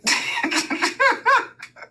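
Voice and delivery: high helpless laugh; real laughter; burst of laughter. Real, not acted. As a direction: crack up at the memory. Laughing at the memory.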